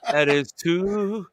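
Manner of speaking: slow mock Spanish. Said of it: "I know a little bit of Spanish".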